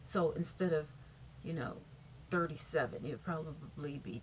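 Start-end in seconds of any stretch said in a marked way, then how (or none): none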